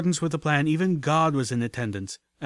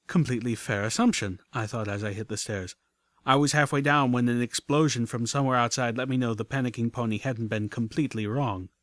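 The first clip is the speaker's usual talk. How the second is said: ordinary delivery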